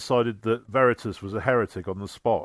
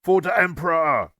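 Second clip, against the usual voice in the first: mild, raised war-cry shout; battle cry; 5 semitones higher, 4 dB louder than usual. A narrator's reading. Disguised orc marching into the Ultramarine camp, shouting. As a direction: shout it higher, like a charging orc.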